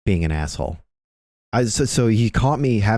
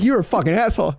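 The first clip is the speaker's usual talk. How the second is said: high mock Jay; 12 semitones higher, faster than usual. Jay voiced.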